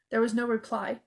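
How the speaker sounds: plain reading voice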